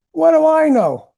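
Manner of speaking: high, loud, laughing at the memory; laughing in disbelief. Real, not acted. Telling the interviewer, laughing in amazement.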